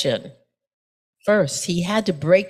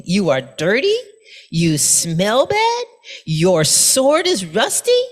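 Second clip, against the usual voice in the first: most disdain; 4 semitones higher than usual. Acted. Monk scorning the burly samurai, with disdain. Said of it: "he replied to him with most disdain".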